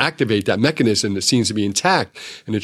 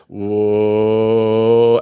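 long, steady, playful whoa; slow suspenseful whoa; drawn-out whoa. Shown as a demonstration. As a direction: stretch the whoa as your hand comes closer. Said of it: "But if I come over and say".

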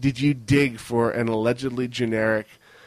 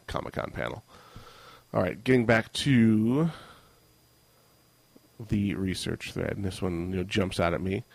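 ordinary talk, nothing marked

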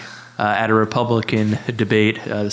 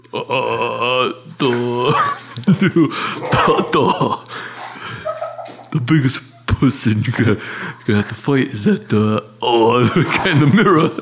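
Sylvester Stallone as Rocky. Acted. Rocky advises young Creed at the mirror, halting boxer impression. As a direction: stumble through it like a punchy old fighter. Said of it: "this is my impression of, uh, Sylvester Stallone".

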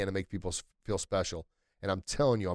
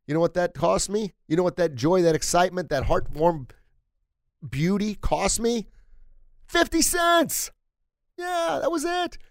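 much higher and louder, slowing to a big reveal; proud excitement; 8 semitones higher, 5 dB louder than usual. Retold or imitated. Father telling his son what the joy cost, swelling excitement. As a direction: build up to the punchline like a showman.

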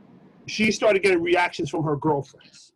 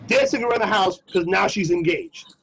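normal talking voice

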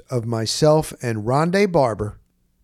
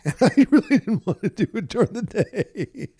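laughing; 7 semitones higher than usual. Real, not acted. Laughing about reluctant chore.

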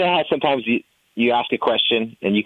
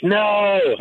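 anguished no; 10 semitones higher than usual. Retold or imitated. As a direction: cry out in mock despair. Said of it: "I was like".

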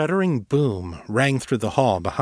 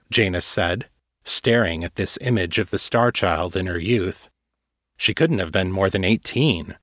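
plain reading voice

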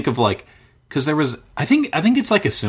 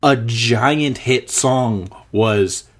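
ordinary talk, nothing marked

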